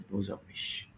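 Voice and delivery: a soft whispered repeat; whispered example. Shown as a demonstration. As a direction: whisper Böse auf mich under the breath.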